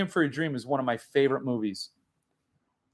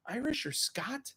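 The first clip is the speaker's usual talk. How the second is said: whisper; 6 semitones higher, 13 dB quieter than usual. Real, not acted. Aside about the wee dram.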